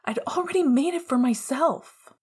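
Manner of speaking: put-on tearful scream at the boy he loves. A narrator's reading. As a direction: scream it through tears. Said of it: "he screamed".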